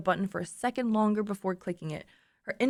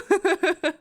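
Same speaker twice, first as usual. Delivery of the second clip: high, loud giggle; laugh; 9 semitones higher, 4 dB louder than usual. Real, not acted. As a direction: crack up in high-pitched giggles. Laughs after the acted greeting.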